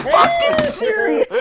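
shouted disbelief. Real, not acted. Disbelief at game play.

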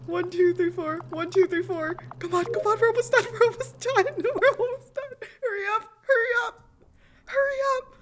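high frantic urging; frantic pleading. Real, not acted. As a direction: beg them to hurry. Frantic countdown as team races.

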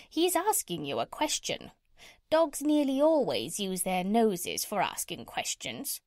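parrot. Acted. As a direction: lecture like a know-it-all parrot, pitch leaping.

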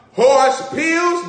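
big loud high shout of the punchline. Acted. Preacher shouting the punchline to the church. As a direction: throw it out to the room full force.

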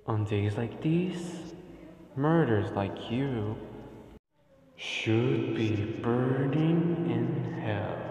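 quietly threatening the enemy; softly sung villain speech; menacing Sans. Pretend.

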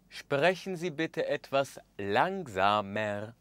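normal talking voice

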